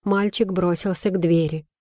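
usual speaking voice

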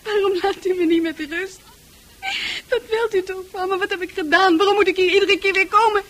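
loud, fast, desperate protest; angry outburst. Acted. Francis furiously pushing back at the doctor's questions. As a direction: lash out at him.

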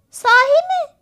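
put-on very high, loud child's exclamation; excited question. A narrator's reading. Andrew hears his brother saw a swordfish, excited. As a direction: burst out with piping excitement.

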